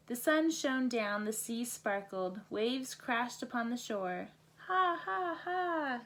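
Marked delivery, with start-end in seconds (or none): 4.7-6.0 high squawking ha ha ha; gull laughter. A narrator's reading. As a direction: cackle like a laughing seagull, high. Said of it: "cried the gulls".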